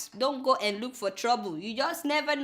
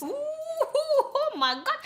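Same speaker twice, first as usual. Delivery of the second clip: amazed; much slower than usual. Real, not acted. Gasping at the huge cake.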